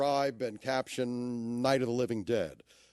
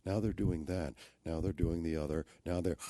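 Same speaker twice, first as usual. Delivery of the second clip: much softer hushed golf-commentator voice; golf announcer; 8 semitones lower, 5 dB quieter than usual. Retold or imitated. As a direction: murmur like a golf announcer at the green. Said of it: "sound like a golf announcer".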